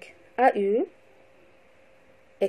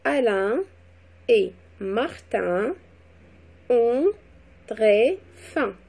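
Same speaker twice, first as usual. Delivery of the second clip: teacher dictating, every word set apart; word-by-word dictation; much slower than usual. Shown as a demonstration. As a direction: repeat the dictation slowly, long gaps between words. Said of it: "mot par mot".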